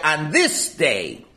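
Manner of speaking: "this day" drawn out slowly with weight; stress on THIS DAY. Shown as a demonstration. Teacher quoting the verse to his class, stressing "this day". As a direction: lean slowly and firmly on "this day". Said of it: "Why do you emphasize the word this day?".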